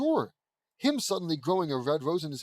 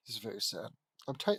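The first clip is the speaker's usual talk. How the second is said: usual speaking voice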